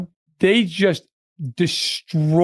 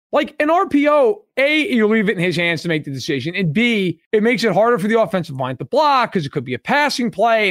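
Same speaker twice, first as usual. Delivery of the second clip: ordinary delivery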